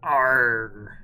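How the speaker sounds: loud pirate growl; pirate arr. Pretend. Pirate joke banter.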